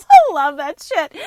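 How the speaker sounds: loud laugh bursting into the words. Real, not acted. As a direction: crack up, then blurt it out high. Delighted laugh at Sylvia memory.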